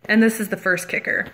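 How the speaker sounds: ordinary delivery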